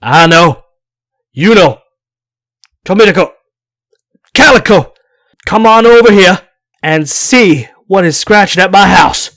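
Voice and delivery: loud, high call out the window to the dogs; a nervous old man calling his dogs. Acted. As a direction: holler for the dogs into the dark. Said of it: "eased to the window and called".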